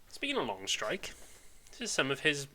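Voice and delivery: ordinary talk, nothing marked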